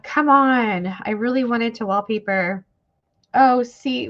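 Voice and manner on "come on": louder, higher, exasperated plea; retold protest, pushed out louder. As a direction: come on, seriously?. Retells own dismay at wallpaper prices.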